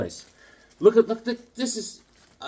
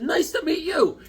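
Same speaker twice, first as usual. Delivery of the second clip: high-pitched reply without the loudness; acted greeting back; 12 semitones higher than usual. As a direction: return the greeting in a raised voice. Own reply to friendly stranger.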